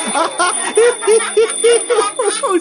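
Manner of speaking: put-on squeaky children's giggles; high giggling. Students tickled in class, giggling. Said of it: "They giggled and snickered".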